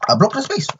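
sudden very high, thin gloating squeal; gleeful squeak. Acted. Telling viewers he blocked the spot, gleefully high squeal. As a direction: squeal it out in triumph.

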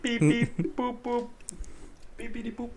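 high robot beeps, fading out; toy robot voice. Pretend. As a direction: beep like a toy robot, trailing off. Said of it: "Hype it up for me, [name]".

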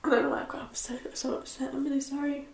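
breaking into tears, hushed and wavering; fighting back tears, barely voiced; getting upset. Real, not acted. Vlogger to her viewers about feeling alone, tearfully upset. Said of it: "I'm getting upset".

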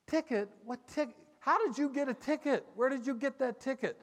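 high, loud, urgent questions; excited. Retold or imitated. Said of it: "Lana says to Donnie".

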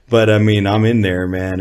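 normal talking voice

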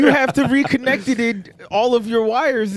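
mock Rocket lecture. Playing Rocket mocking the villain.

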